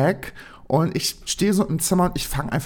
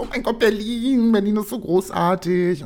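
higher-pitched mock enthusiasm; imitated gushing; 5 semitones higher than usual. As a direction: gush like an impressed new acquaintance. Others gush about Berlin.

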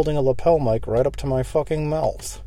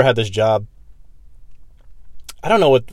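normal talking voice

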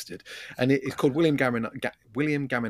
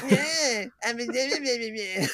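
very high mock lady voice; squeaky put-on nagging of a wife; wife impression; 12 semitones higher than usual. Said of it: "I just sounded like my wife".

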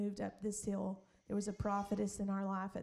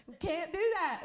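ordinary talk

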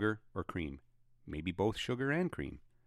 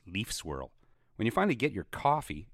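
ordinary talk, nothing marked

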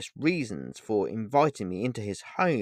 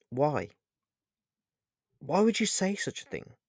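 higher-pitched, hurt annoyance; annoyed; 5 semitones higher than usual. A narrator's reading. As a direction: demand a reason, mildly irked. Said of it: "noticeably annoyed".

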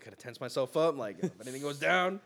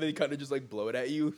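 ordinary delivery

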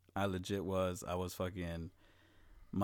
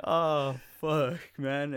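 mock dismay; 7 semitones higher, 7 dB louder, much slower than usual. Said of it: "I'm like".

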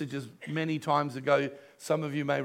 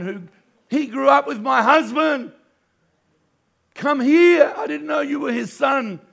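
higher and louder, bursting with joy; excited outburst; 5 semitones higher, 6 dB louder than usual. Retold or imitated. Pastor retelling the warehouse owner's joyful words, excited shout. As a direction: call it out like wonderful news.

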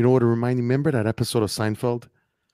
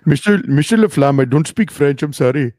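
stammering, higher put-on caller voice; flustered scammer; 4 semitones higher, 5 dB louder than usual. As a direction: play the caught-out caller squirming. Friend mimics flustered scammer.

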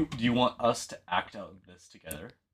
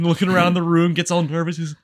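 usual speaking voice

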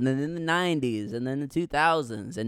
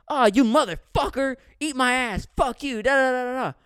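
put-on higher, louder cursing kid; hammed-up swearing kid; mock angry kid; 9 semitones higher, 6 dB louder than usual. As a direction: rant like a suddenly bratty boy. Timid boy suddenly swearing.

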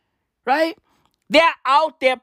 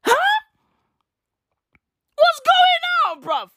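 high squeaked shock; startled; 4 dB louder than usual. Real, not acted. Reacting to the protest scene, shocked squeak. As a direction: jump in pitch, shocked.

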